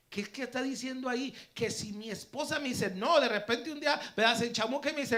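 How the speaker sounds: loud, high-pitched, driving sermon delivery; forceful preaching. Real, not acted. Preacher explaining the verse to his congregation, forceful preaching. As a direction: drive the point home to the congregation.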